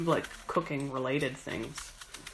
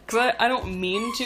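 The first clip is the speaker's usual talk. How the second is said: laughing through words; 6 semitones higher, 4 dB louder than usual. Real, not acted. Laughing after mention of accent.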